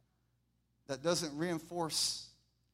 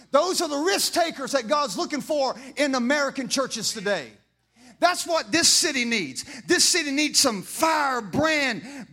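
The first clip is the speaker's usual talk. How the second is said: loud, higher sermon delivery building to a climax; preaching at full force; 7 semitones higher, 12 dB louder than usual. Acted. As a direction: drive the congregation up, louder each line.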